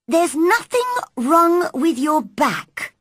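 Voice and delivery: put-on high, cross child's verdict; cross, childish. A narrator's reading. Girl after examining the boy's back, cross and childish. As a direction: pronounce it crossly in a high girl's voice. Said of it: "those crossly spoken, childish words".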